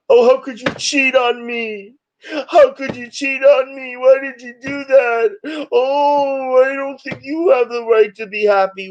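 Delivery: high, whiny mock sobbing; shrill sobbing outrage. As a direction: wail it like a jilted ex. Ex-partner voiced crying in reading.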